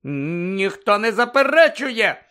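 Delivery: high, drawn-out loud protest; almost shout it, slowly; nearly shouting. A narrator's reading. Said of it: "майже кричала місіс Візлі".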